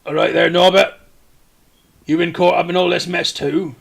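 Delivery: loud, hearty dwarf greeting; Escobert the Red. Acted. Castellan greets Norbert caught up in the mess, hearty dwarf. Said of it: "this is Escobert the Red".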